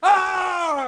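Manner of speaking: loud high battle cry; triumphant yell. Retold or imitated. Acting the thug ripping both claws, a loud high yell. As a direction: roar it out.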